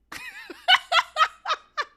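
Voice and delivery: high, loud burst of laughter; big laugh. Real, not acted. Podcaster laughing at her own boast, highly amused.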